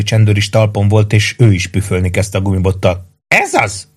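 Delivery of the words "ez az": very high cry without much volume; shouted cheer. A narrator's reading. Maggio eggs on both policemen, a high-pitched cry. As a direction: cry out high, egging them on. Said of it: "kiáltotta Maggio".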